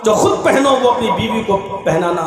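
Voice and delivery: full preaching. Acted. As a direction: proclaim it from the pulpit. Preaching the sermon's point.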